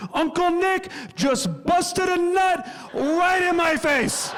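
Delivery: high, drawn-out yell; slow, high-pitched child's shout; imitated kid yelling. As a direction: holler it out like a kid across a crowded restaurant. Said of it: "just yells out in this crowded restaurant".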